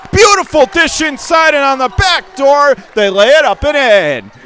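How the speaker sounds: excited call. Real, not acted. Back-door layup.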